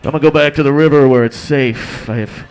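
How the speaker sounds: loud, sheepish bear backing off; flustered bear. Pretend. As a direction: apologize fast and flee like a spooked bear. Bear retreating apologetically.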